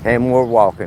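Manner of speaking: ordinary talk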